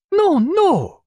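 high, loud French protest; excited Frenchman cry. A narrator's reading. Pontécanet cries out over the chess move. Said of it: "cried Pontécanet".

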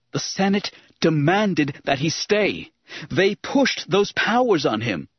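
high, slow, emphatic protest; heated retort. A narrator's reading. Anakin argues back at Obi-Wan, heatedly.

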